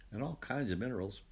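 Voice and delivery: ordinary delivery, nothing marked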